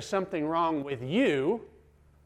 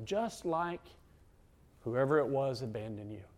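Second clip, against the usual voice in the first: soft, hushed insinuation; insinuating accuser; 2 semitones lower, 5 dB quieter than usual. Acted. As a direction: play the accuser planting doubt quietly. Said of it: "That voice will also say".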